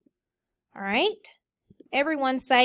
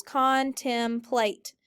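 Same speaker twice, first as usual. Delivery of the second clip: syllables counted; much slower than usual. Shown as a demonstration. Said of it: "Hold up how many fingers of syllables".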